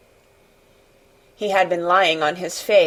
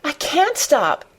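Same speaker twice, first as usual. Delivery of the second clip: put-on high, slow wail of a distressed boy; tearful high-pitched gasp; gasping and sobbing; 8 semitones higher, slower than usual. A narrator's reading. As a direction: plead helplessly through acted sobs. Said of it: "he gasped and sobbed".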